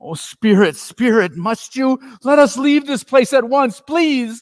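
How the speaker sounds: put-on high, loud pleading old man; distressed Scrooge pleading with the spirit; begging Scrooge. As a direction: beg the spirit to take you away. Scrooge begs the ghost.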